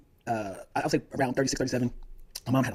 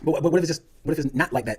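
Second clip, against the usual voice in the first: ordinary talk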